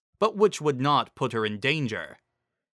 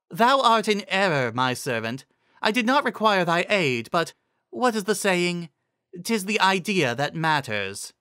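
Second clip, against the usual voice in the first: higher, lofty musing; regal Celestia; 7 semitones higher than usual. Acted. Celestia pronouncing on Spike's name, higher and lofty. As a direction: speak down from on high.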